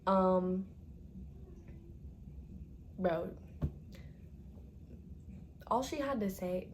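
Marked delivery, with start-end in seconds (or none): none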